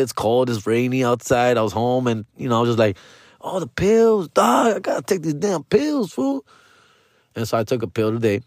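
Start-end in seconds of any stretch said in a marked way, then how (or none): 3.4-6.4 high-pitched mock realization; sudden remembering. Man at home remembering his pills, sudden realization. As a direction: blurt it as if it just hit you, pitch jumping up.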